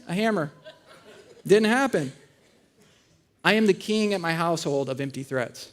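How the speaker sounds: ordinary talk, nothing marked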